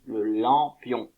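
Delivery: le lampion said slowly, each syllable drawn out; slow clear model word. Shown as a demonstration. Teacher to pupils, sounding out the word slowly. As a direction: spell out lampion slowly for the class. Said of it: "Ça s'appelle un lampion".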